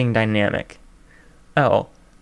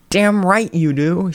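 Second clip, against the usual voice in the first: slow, indignant outburst; furious exclamation; 6 semitones higher, slower than usual. Acted. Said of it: "she exclaimed furiously".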